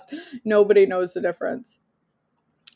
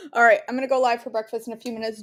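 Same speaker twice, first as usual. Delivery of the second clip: normal talking voice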